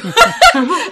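high, loud burst of laughter. Real, not acted. Laughing at her own broken-man line, loud high burst of laughter.

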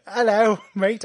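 a quick hammed-up Aussie greeting; mock Australian. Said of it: "I turned Australian for a moment".

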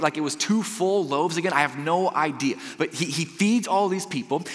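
normal talking voice

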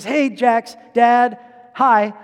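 put-on little kid voice. Boy on the headset greeting his friend's dad, in a young kid voice. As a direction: chirp it like a small boy. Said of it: "I sound like I'm five".

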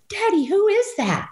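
put-on high, wide-eyed child asking daddy; girl pointing at the screen, asking her father in a high child voice; little girl voice. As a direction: squeak it like an eleven-year-old. Young girl asking her dad.

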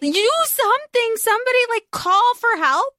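high-pitched, incredulous plea; exasperated urging. Real, not acted. Reader urging an injured heroine to get help, exasperated. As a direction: plead with a character who won't act.

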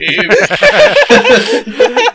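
high loud laughing burst; loud burst of laughter. Real, not acted. City Escape video reveal.

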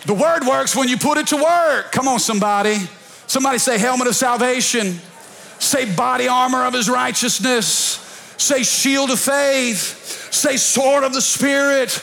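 high, rousing call-and-response lead; full-force preaching. Real, not acted. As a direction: get the congregation repeating after you. Leads congregation in call and response.

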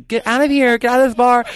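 high, loud yell to throw her out; angry drag queen yelling at a drunk woman on the dance floor. Retold or imitated. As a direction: shout her out of the bar. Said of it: "I yelled at her".

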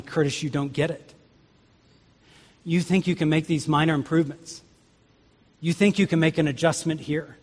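soft, measured voice of a kindly God; kind, gentle correction. Acted. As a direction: break it to him gently, unhurried. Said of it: "if God in his kindness".

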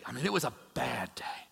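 whispered aside within the excuse; mock whisper. Preacher's imagined king confides his excuse in a whisper.

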